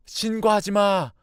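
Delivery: high, loud, drawn-out command; alarmed shout. Acted. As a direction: shout to stop the call. Cornered intruder yells not to report. (다급하게 길게 소리치기)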